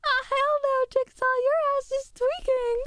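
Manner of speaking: hammed-up high squawk at the avatar; silly character voice. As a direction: shriek it like a cartoon character losing it. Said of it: "I'm normal".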